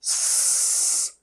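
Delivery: स drawn out slowly as a soft teeth hiss; slow whistling स through near-closed teeth; स stretched out as a soft hiss; hissed s sound. Shown as a demonstration. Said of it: "दोनों दांत लगभग मिल जाते हैं".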